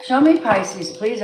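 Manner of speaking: high, loud plea to the deck; loud, high show me Pisces; eager call to the cards. Real, not acted. Calling on the cards.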